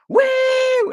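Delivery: very high loud excited whoop. Pretend. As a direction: whoop with glee. Whooping at tornado.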